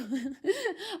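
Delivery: bright burst of laughter; short real laugh. Real, not acted. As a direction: laugh at yourself briefly. Laughs mid-sentence about career.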